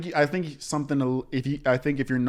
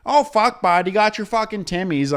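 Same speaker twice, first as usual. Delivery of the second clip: much higher, louder over-the-top Canadian voice; mock Canadian accent; 9 semitones higher, 7 dB louder than usual. TikToker switching on his Canadian accent when cameras roll. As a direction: snap into a cartoon Canadian for the camera. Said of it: "And then cameras come on".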